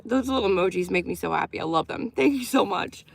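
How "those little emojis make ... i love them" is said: usual speaking voice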